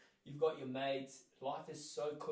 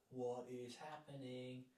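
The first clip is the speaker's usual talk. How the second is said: quiet disbelief; 6 dB quieter, slower than usual. Retold or imitated. Stranded on traffic island.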